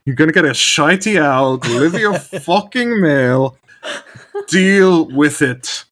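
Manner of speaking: loud, high Shrek-style Scottish rant; Scottish accent. Shown as a demonstration. Asked to say Shrek's line, loud Scottish rant. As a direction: bellow it like an angry Scottish ogre. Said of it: "lean into the Scottishness".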